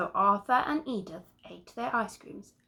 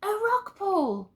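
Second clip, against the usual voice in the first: high, loud, slow cry of discovery; excited shout; 11 semitones higher, 5 dB louder than usual. Acted. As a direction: shout out the find. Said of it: "shouted Arthur".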